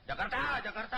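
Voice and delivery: very high, over-eager conductor's yell; practice tout's shout. Pretend. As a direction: belt out the destination far too keenly. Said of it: "teriak-teriak lagi". (latihan teriak kenek bernada melengking)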